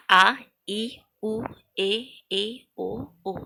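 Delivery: आ, ई, उ, ए, ए, ओ, ओ each held as a long vowel. Shown as a demonstration. Teacher listing the seven long vowels for students, each one held. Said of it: "दुगुना समय लगता है".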